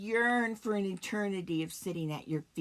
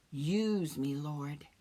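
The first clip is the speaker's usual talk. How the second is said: ordinary talk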